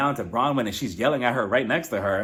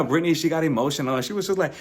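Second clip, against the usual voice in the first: ordinary delivery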